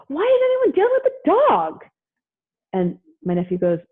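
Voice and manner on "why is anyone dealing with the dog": very high, loud mock freak-out; frantic sister. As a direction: imitate an exasperated outburst, voice shooting way up. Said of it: "why am I talking like this".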